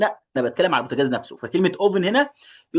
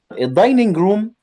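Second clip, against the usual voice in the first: الدايننج روم said slow and high; modelled word; 7 semitones higher, 6 dB louder than usual. Shown as a demonstration. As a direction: present الدايننج روم slowly, raised, clear. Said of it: "teacher models word".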